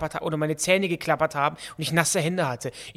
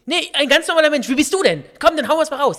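high, loud challenge; aggressive comeback; 11 semitones higher, 9 dB louder than usual. Real, not acted. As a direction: throw it back loudly and provoke an answer. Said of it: "Bennys Aggressionsproblem". (aggressiv und laut herausfordernd)

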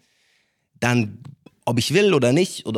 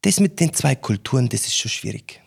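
southern German mother. Retold or imitated. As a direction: mimic his mother's south German dialect gently. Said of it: "meine Mutter redet so süßdeutsch". (Mutter erzählt im süddeutschen Dialekt, leicht angedeutet)

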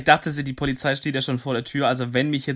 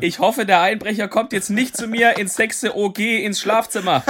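slow, high, laughing announcement; broadcast it to the whole house; 8 semitones higher, slower than usual. Pretend. Mock loud call to burglar.

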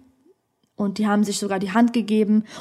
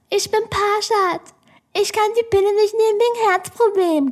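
ape her voice in a much higher, slowed, wannabe-womanly whine; mocking girly imitation; 10 semitones higher than usual. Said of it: "imitiert meine Stimme, richtig ekelhaft, möchte gern fraulich".

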